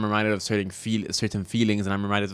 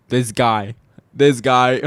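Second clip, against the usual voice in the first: louder each time, pointing at herself; mock blame; 3 semitones higher, 8 dB louder, slower than usual. Real, not acted. Vlogger blaming herself for her limits, mock accusing. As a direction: accuse yourself with a grin.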